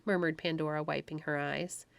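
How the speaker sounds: usual speaking voice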